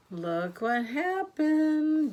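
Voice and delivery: surprised gasp. Acted. Grandma gasping for the kids at a turn in the story. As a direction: draw the kids in with a gasp, slowly. Gasp reading picture book aloud.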